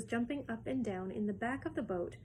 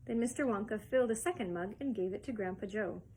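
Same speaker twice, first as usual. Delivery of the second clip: plain reading voice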